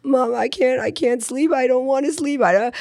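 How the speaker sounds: put-on high, whiny little-girl voice; whiny toddler. Toddler begging mom at bedtime, high whiny voice. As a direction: whimper it like a tired kid who won't sleep. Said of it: "she'd be like".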